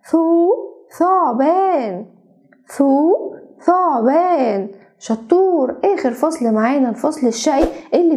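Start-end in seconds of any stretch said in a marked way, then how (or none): 0.1-2.1 ثعبان drawn out very slowly; slow spelled-out. Shown as a demonstration. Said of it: "قول معايا". 2.7-4.7 ثعبان drawn out very slowly; slow spelled-out. Shown as a demonstration. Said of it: "قول معايا".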